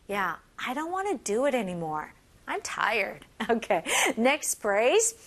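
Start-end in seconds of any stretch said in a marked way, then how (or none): none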